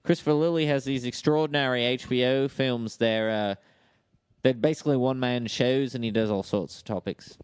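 matey Aussie explainer; Australian accent. Acted. Australian telling co-hosts about HBO shows, Australian accent. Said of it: "Australian accent".

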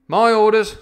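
short, loud, high-pitched command; curt captain. A narrator's reading. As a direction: cut them off as the captain. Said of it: "said the captain shortly".

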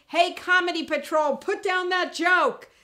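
high, loud mock police order; mock comedy cop. Nobody's gonna police your joke, loud mock command. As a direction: call out like a comedy enforcer.

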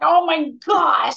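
high, slow, thrilled cry of oh my gosh; excited shout. Retold or imitated. Recalling the call from National Geographic, a slow thrilled high cry. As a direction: squeal it out in disbelief. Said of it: "I was so excited".

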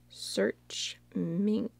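whispers the search words while typing; whispered. Real, not acted. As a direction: breathe it under your breath, reading the screen. Whispers what she types in.

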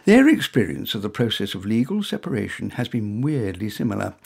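ordinary delivery

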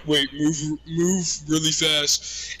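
drawn-out, slowed speech; mock slow-motion talk to a friend. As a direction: stretch each word like slow motion. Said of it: "like, slow motion voice".

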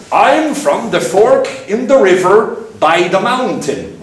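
high, sing-song mock Swedish accent; bad Swedish accent. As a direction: ham up a clumsy Swedish accent. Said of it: "put on my really bad Swedish accent".

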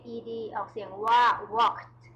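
"walked" ending in a crisp t, slowly; "walked" with one syllable, ending t; ed as t sound. Shown as a demonstration. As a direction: say "walked" slowly, final t clear.

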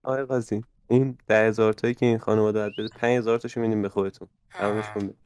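low, hushed, quick aside; conspiratorial undertone, soft and low; furtive bribe offer. Pretend. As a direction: slip the judge a secret deal. Lawyer offers judge a bribe.

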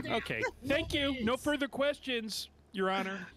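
high, pleased courtroom closer; mock lawyer. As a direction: rest the case like a smug lawyer.

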